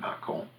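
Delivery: Mâcon ending in a nasal vowel; Mâcon in French, mah-KON with silent N; nasalized French pronunciation. Shown as a demonstration. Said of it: "you don't pronounce the N, you nasalize it".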